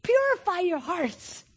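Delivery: high, slow, drawn-out call; excited command. Acted. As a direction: stretch each word in eager command. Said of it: "he gets so excited".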